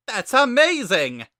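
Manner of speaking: high, loud, booming skeleton brother; loud high cartoon brother voice; Papyrus voice. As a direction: bellow it out like an eager kid brother.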